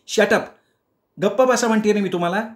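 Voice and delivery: sharp high fast snap; snapping wife. Acted. Wife snaps at husband to shut up, sharp and fast. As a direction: bark it at him, fed up. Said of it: "बायको परत खेकसली".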